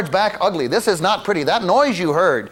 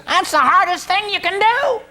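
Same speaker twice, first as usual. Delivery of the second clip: much higher imitation of the old professor; imitating the professor; 12 semitones higher than usual. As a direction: quote the professor in a high put-on voice. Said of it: "And he said".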